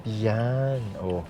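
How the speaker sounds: ordinary delivery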